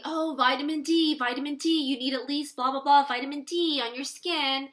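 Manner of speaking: parroting the doctor's advice; mocking the doctor. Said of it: "even my doctor said".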